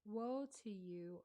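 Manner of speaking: ordinary delivery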